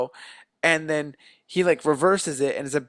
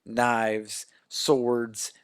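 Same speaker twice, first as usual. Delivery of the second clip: normal talking voice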